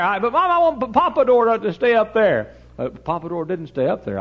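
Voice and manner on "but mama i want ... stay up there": put-on high, loud kid's complaint; protesting son. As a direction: whine back at Mama at full volume. Boy protesting to his mother.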